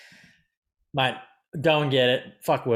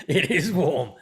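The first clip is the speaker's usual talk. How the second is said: said higher through a laugh; amused, laughing complaint; 4 semitones higher, slower than usual. Real, not acted. Host remarking on the warm room, laughing.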